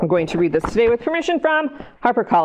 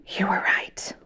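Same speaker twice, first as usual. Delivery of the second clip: gasp, then softer whispered awe; hushed whisper; 2 semitones lower, 7 dB quieter than usual. Acted. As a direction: whisper the surprise to the kids. Rain arrives in story.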